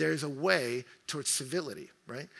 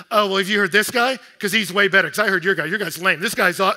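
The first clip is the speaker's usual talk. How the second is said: loud, higher scoffing brag about his pastor; mock one-upping; 5 semitones higher, 14 dB louder than usual. Churchgoer dismissing a friend's pastor, loud mocking scoff. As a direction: sneer and boast, ham it up loudly. Acted snobby reply to a friend.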